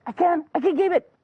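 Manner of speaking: high-pitched squeal of joy; put-on excited woman voice; thrilled disbelief. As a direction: burst out delighted, very high. Wife told she may keep baby.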